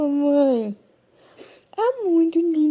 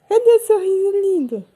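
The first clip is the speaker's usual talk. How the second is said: ordinary delivery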